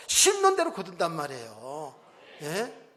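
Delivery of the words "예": usual speaking voice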